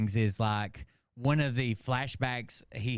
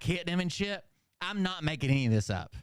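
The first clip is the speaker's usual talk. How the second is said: ordinary delivery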